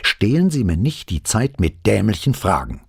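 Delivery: angry shout. A narrator's reading. Investigator fed up with stupid questions, shouting angrily. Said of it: "schrie Brunetti".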